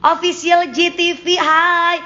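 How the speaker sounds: light up to greet the viewer by name; bright greeting. Real, not acted. Greeting Official GTV in the live chat, excited and high.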